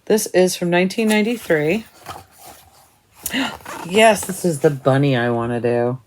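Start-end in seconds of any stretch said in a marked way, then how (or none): 3.2-3.8 sharp, high gasp of delight. Real, not acted. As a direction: gasp at the chart you've been hunting for. Finds the bunny chart she wants.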